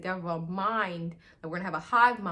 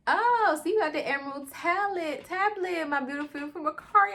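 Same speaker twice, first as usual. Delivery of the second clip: high-pitched mock sweet talk; gushing imitation; 9 semitones higher than usual. Drew greeting beautiful Makari, hammed-up high gushing. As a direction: coo it high, hamming up the flirting.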